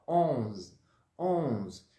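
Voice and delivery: onze with a strongly nasal on, short; nasal French onze. Shown as a demonstration. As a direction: hum the on through the nose. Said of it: "ele vai ficar com o N bem nasalado".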